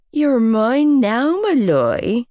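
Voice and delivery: mock her voice back at her; mimicking her voice. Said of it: "he mimicked my voice".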